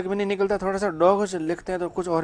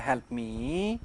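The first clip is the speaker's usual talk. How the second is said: quiet, lower help मी with a gentle lift on मी; soft request; 5 semitones lower, 7 dB quieter, much slower than usual. Shown as a demonstration. Teacher showing the class a request said gently. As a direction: ask softly, like begging a favour. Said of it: "tone कैसे होगा".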